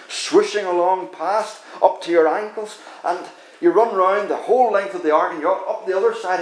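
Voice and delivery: usual speaking voice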